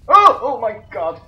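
high, loud jolt easing slightly; startled yelp. Real, not acted. Player startled by something wriggling in the game, startled yelp. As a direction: yelp first, then exclaim loudly.

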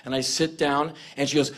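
ordinary talk

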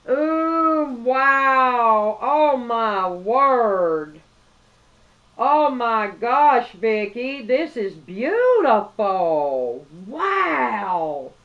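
loud, slow, drawn-out wows; awed gasps. Real, not acted. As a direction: gasp at the gift like it takes your breath away. Opening the gift, overwhelmed.

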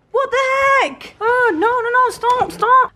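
high, loud panicked cries of no and stop; panicked pleading. Real, not acted. Stuck behind the door as water rises, high loud panic.

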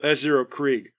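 usual speaking voice

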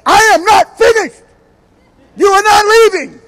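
high, loud, full-force yell; confrontational shout. Acted. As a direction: shout it as if blocking the way out. Wife confronts husband walking out.

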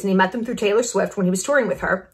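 normal talking voice

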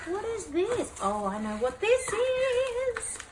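high excited slow exclaim; delighted gasp of recognition. Real, not acted. Excited unboxing.

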